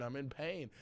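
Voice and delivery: normal talking voice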